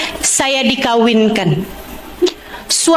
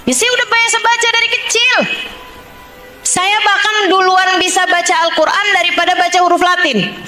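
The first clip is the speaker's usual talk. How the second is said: high, insistent self-defence; retold protest; 6 semitones higher than usual. Wife explains she read Quran since childhood, retold protest. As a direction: re-enact a wife arguing back, pitched up.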